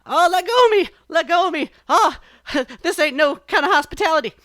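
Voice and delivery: panicked rabbit. A narrator's reading. Brer Rabbit, hand stuck, yells at the sap person in panic. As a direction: flail and shout, stuck and panicking. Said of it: "And he says".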